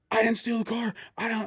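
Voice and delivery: high, frantic denial; panicked protest. Retold or imitated. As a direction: plead innocence in a panic. Client panicking at traffic stop.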